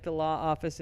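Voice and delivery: ordinary delivery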